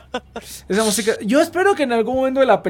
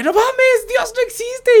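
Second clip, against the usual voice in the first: much higher shocked woman voice; much slower than usual. Retold or imitated. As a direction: gasp it in disbelief.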